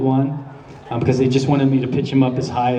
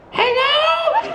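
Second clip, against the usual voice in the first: put-on much higher falsetto old-lady hello; terrible girl voice. Shown as a demonstration. Voice actor demoing his terrible girl voice, shrill falsetto. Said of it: "I do a terrible girl voice".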